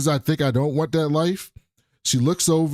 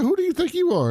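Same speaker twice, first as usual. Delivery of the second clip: mocking woman; faster than usual. Retelling a woman's haughty retort.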